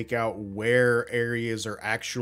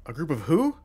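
puzzled questioning; 3 semitones higher than usual. Acted. Cultist questions the disguised player.

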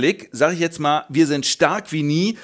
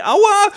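very high yelp, louder; mock pain yelp; 7 dB louder than usual. Morgoth's face hacked by the eagle, high comic yelp. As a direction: squeak a comic ouch.

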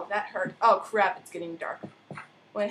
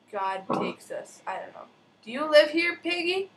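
ordinary talk, nothing marked

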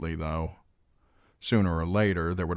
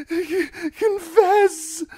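weeping; 8 dB louder, much slower than usual. A narrator's reading. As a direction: CONFESS stuttered in a high, loud sob. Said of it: "Stop that weeping".

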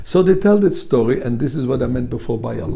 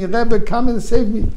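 high, loud desperate cry to the Rebbe; mock scream for help; 8 semitones higher, 5 dB louder than usual. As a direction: call out for rescue, hammed-up. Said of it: "This chassid starts screaming".

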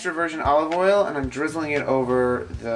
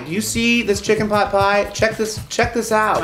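calling out; 6 semitones higher, 5 dB louder than usual. Pretend. Calling out to Obama.

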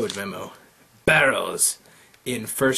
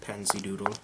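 whispering; 4 semitones lower, 8 dB quieter, faster than usual. Real, not acted. Muttering to herself while exploring.

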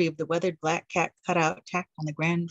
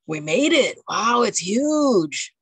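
awed surprise; 4 semitones higher, 5 dB louder, slower than usual. Acted. Arriving at the huge mansion.

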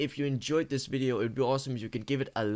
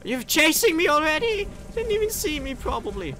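high panicked disbelief; being chased; 6 dB louder than usual. Real, not acted. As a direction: squeal as the monster gives chase. Freddy chasing the player.

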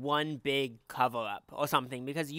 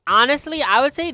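loud emphatic; 11 semitones higher, 14 dB louder than usual. Real, not acted. Loud emphatic verdict opener.

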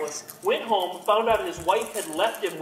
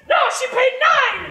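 goblin wife; 10 dB louder than usual. Acted. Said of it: "my wife comes out".

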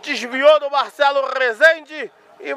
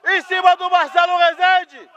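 excited play-by-play; 8 semitones higher, 3 dB louder than usual. Real, not acted. Live play shouted.

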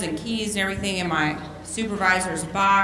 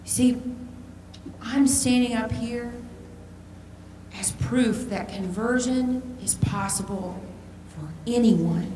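usual speaking voice

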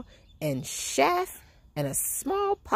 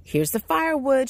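put-on higher, eager boy's shout; 8 semitones higher than usual. A narrator's reading. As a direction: call it out proudly. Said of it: "shouted Jack".

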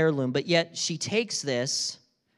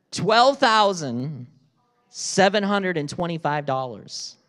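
dramatic reveal; 4 dB louder than usual. Real, not acted. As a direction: drag it out for suspense. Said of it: "slow dramatic price reveal".

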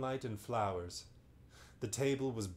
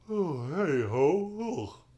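loud drawn-out yawn, high and slow; big yawn; 6 semitones higher, 8 dB louder, much slower than usual. Acted. Magician yawning in front of Lucy, slow and loud. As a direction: stretch into a sleepy yawn. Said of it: "There, I'm yawning again".

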